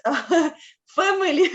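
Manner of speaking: bright laugh breaking into the word family, pitched high. Real, not acted. Describing her friends abroad to the panel, laughing happily. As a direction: let the word family ride up on a delighted laugh.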